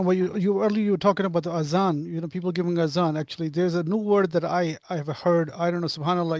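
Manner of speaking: ordinary delivery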